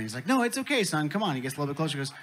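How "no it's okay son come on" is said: a much higher coaxing pitch; lightly voiced reassuring dad. Alien dad coaxes worried son closer, reassuring. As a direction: brush off the worry, pitched up.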